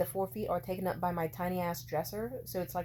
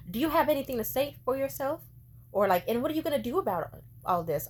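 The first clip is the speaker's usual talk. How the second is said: raised, demanding imitation of mom, higher and louder; 6 semitones higher, 6 dB louder than usual. Mom demanding an answer after her rant, raised and stern. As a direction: mimic mom's scolding demand.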